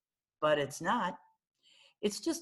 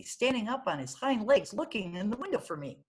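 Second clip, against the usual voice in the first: ordinary delivery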